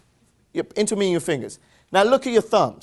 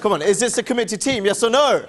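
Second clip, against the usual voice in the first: rallying the crowd; 3 semitones higher than usual. Real, not acted. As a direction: fire up the room for a bigger answer. Urging a weak crowd to answer louder.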